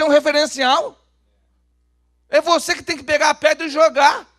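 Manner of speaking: high, very loud exhortation; full-force preaching. Real, not acted. Preacher challenges church leaders to be seen, full-force preaching. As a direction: preach it hard at the top of the voice.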